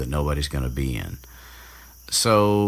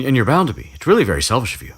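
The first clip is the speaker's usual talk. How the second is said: higher, fast prissy lecture; mock bossy rant; 8 semitones higher, 5 dB louder, much faster than usual. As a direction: rattle it off like a know-it-all. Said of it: "her little rant".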